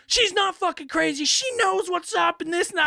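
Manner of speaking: mock defensive friend. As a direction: squeal it as the loyal friend. Voicing caller's friend.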